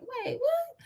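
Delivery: high, soft startled wait what; startled disbelief. Retold or imitated. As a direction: caught off guard, squeak it out. Sudden shock at hearing aid.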